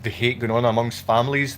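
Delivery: ordinary delivery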